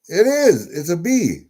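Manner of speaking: pleased to be proven right; excited confirmation. Real, not acted. Spotting the bee on a coin, excited.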